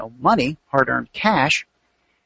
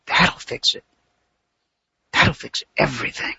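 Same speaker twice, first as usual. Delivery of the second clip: whispered hope; 3 semitones lower than usual. Retold or imitated. Trader's hopeful self-talk.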